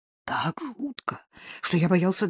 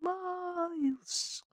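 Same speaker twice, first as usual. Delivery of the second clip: high, soft, drawn-out call; faint distant cry; 10 semitones higher, 10 dB quieter than usual. A narrator's reading. Lost dog's owners calling from afar, faint cry. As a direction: call faintly, as if through cotton wool. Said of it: "я услышал слабый крик".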